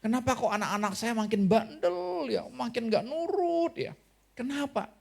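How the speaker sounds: a bewildered parent's complaint; exasperated parent. Retold or imitated. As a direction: voice a fed-up parent puzzled by a stubborn child. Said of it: "Mesti saudara cerita ya".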